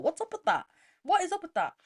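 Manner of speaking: high-pitched indignant demand; angrier questioning. Retold or imitated. Retelling her rant at the British, indignant. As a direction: demand an answer, pitch jumping up. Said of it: "I'm getting angrier and angrier".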